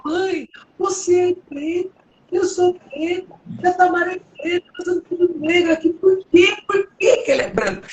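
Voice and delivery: put-on high, pleading child's voice near tears; upset little girl. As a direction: voice the hurt little daughter asking why. Little daughter's protest, retold.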